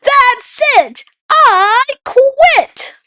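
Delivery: a monkey yelling after she nearly gets eaten. Acted. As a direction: throw a fit and yell. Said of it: "She yelled to the sky".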